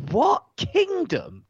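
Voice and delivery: high, slow disbelieving "what kingdom"; a baffled viewer scoffing at the presenter; incredulous. Retold or imitated. Incredulous mock shout of disbelief at the presenter's words. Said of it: "You're like".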